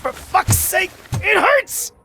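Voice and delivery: high, loud shout of pain. Acted. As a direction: yell through the pain.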